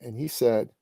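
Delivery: ordinary talk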